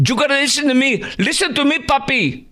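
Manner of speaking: very high, exaggerated parody of a politician; mocking Harris. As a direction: ham up her lines in a squeaky high voice. Host parodies Harris clip.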